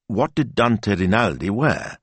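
usual speaking voice

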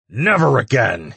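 loud, high fierce vow. A narrator's reading. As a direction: swear it with force. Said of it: "he vowed".

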